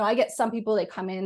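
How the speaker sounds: ordinary talk, nothing marked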